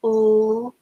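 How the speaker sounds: "oo" held as a long oo vowel; oo sound. Shown as a demonstration. Teacher sounding out the vowel for students. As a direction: sound out "oo" as in spoon. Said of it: "the two O's have the oo sound".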